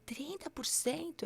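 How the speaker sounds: breathless high whisper of disbelief; stunned whisper. Retold or imitated. Patient repeating the doctor's odds in a stunned whisper. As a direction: whisper it, unable to believe the number.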